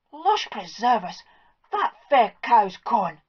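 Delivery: slow, lifted Scots exclamation of dismay; shocked wife holding up her hands; aghast farm wife. Acted. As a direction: throw up your hands in horror. Mistress aghast at the boy.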